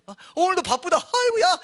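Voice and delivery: high, loud comic devil complaining of work; harried devil. Acted. Busy devil acted out.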